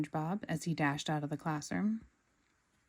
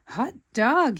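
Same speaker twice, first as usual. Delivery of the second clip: higher, much louder gleeful yell; 6 semitones higher, 10 dB louder than usual. A narrator's reading. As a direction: cheer at the sight of customers. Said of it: "he yelled".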